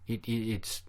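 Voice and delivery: stammering kid in a mock Mexican accent; hesitant, imitated Mexican accent. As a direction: stumble into it with a put-on Mexican accent. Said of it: "The horrible accent".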